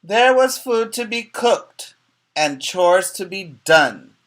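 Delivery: high, loud storybook read, slowing at the end; sing-song picture-book reading; reading to children. Acted. Teacher reading the rhyming story to the class, sing-song.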